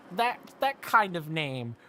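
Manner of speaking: ordinary talk, nothing marked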